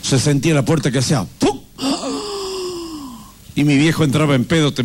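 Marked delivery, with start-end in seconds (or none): 1.8-3.1 high sharp gasp; frightened gasp. Retold or imitated. Kids gasp as father's door slams. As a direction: gasp in fear.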